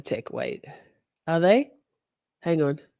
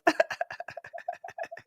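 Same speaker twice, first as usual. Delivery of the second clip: high wheezing laugh; 11 dB quieter than usual. Real, not acted. Cracking up alone onstage.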